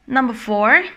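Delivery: ordinary talk, nothing marked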